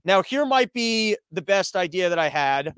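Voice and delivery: normal talking voice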